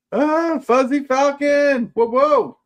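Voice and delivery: high, loud, drawn-out hail; stretched high-pitched whooping hello; excited greeting. Real, not acted. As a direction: call out a welcome in a very high voice. Greeting a viewer joining the stream.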